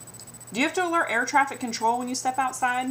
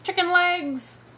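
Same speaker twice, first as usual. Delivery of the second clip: mock taunts; 9 semitones higher, much slower than usual.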